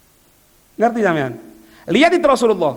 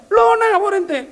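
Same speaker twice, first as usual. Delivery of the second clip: high, sharp rebuke; scolding friend; 11 semitones higher, slower than usual. Retold or imitated. Friend scolds the giver for his careless gift, high and sharp. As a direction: scold him for acting rashly.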